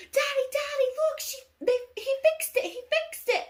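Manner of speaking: very high, loud kiddie squeal; excited little girl. Retold or imitated. Little girl to Daddy on finding her mended bear, excited squeal. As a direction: imitate a child calling out in delight. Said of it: "the little girl".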